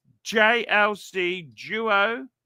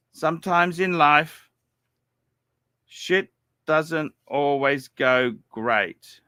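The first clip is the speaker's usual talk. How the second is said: usual speaking voice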